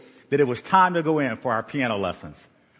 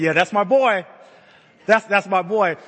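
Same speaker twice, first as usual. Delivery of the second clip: proud dad; 6 semitones higher, 5 dB louder than usual. Retold or imitated. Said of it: "looked over at the rest of our friends and like".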